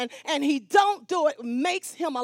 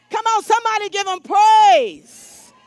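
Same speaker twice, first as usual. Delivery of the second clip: preacher's loud rallying cry; rousing shout; 4 semitones higher, 9 dB louder than usual. Real, not acted. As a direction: call the congregation to praise, full voice. Preacher rousing congregation to praise.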